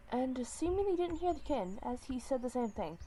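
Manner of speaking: plain reading voice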